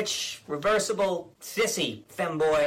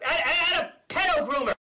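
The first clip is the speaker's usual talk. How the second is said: higher, sputtering shout; mock shout; 5 semitones higher, 3 dB louder than usual. Governor sputters accusations at critics, shouting. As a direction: yell it like a cornered blowhard.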